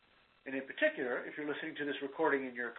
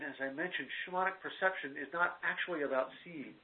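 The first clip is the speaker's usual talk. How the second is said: ordinary delivery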